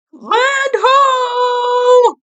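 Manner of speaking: high, loud goofy shout. Acted. Ed calling out after hitting the wall, shouting. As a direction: bellow it out in dopey joy. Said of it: "Ed shouted".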